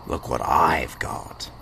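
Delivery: slow hushed whisper. Acted. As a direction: breathe it secretly. Secret treasure reveal, whispered.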